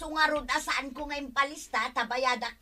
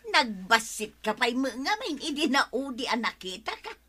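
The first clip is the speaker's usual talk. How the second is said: usual speaking voice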